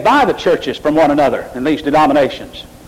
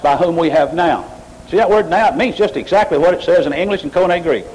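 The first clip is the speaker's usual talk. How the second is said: usual speaking voice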